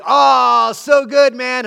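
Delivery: excited praise shout. Retold or imitated. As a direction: shout your delight at the solo. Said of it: "he's like".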